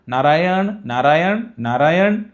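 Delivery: crying out. Acted. Dying Ajamil calling to his young son, crying out. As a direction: call the name out repeatedly, lifting the end. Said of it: "तो ओरडायला लागला".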